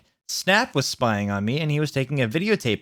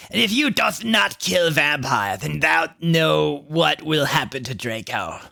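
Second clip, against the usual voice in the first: slow, hammed-up threat; villain shout; 3 semitones higher than usual. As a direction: threaten him slowly like a stage villain. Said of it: "he shouted".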